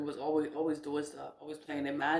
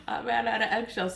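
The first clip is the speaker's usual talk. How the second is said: amused, higher-pitched repeat; laughing; 5 semitones higher, faster than usual. Real, not acted. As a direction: say it quickly through a laugh.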